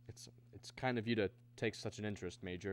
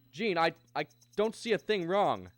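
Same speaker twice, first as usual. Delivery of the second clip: raised, louder, stammering frustration; falter, then burst out helplessly; agitated and at a loss; baffled alarm; 7 semitones higher, 7 dB louder than usual. Acted. Stalled car during escape.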